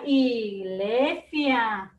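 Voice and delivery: iglesia in long, loud syllables; drawn-out word sounding. Shown as a demonstration. Teacher presenting a new word to children, drawn-out word sounding. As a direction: stretch "iglesia" out, holding i and e. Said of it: "La iglesia sí empieza con I".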